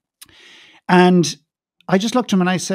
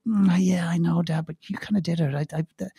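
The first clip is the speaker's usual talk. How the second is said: imitated hesitant mumble, sinking softer; reluctant reply; 6 dB quieter than usual. Daughter hedging to Dad's advice, reluctant. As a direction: hum first, then half-agree under your breath.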